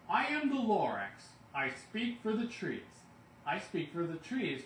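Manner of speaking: loud bossy Lorax voice; Lorax shouting. A narrator's reading. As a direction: bellow it for the whole forest to hear. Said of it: "at the top of my lungs".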